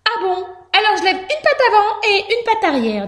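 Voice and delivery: high little mouse voice; obliging mouse. A narrator's reading. Mouse raising paws for the bandit, high-voiced. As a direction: think it through in a high squeaky voice.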